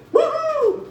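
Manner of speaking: high hammed-up party whoop; mock dorm whoop. Comic imitating a stoned dorm dude, whooping. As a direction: whoop like a stoned college kid.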